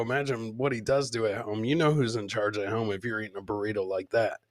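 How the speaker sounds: ordinary talk, nothing marked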